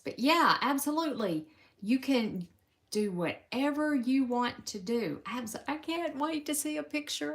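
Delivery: excited reply to a viewer's question; excited answer. Real, not acted. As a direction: answer a viewer with a happy burst.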